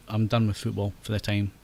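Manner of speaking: ordinary talk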